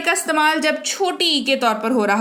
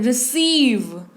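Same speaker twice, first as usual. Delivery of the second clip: Receive slowly, leaning on the long "ee"; receive with weight on SEEV; stressed long "ee"; much slower than usual. Shown as a demonstration. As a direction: stretch the second syllable of receive, "ri-SEEV". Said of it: "जोर पड़ रहा है".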